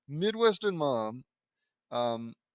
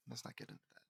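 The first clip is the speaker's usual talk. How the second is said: unvoiced whisper, much softer; whispered aside; 3 semitones lower, 19 dB quieter, faster than usual. Real, not acted. Narrator dropping a touchy subject to himself, whispered. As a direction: hush it away under your breath.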